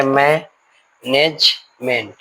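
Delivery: Management slowly, a pause after मै and नेज; syllable by syllable. Shown as a demonstration. Teacher counting out first, second and third syllable, spaced apart. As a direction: sound out management in three separate beats. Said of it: "first syllable".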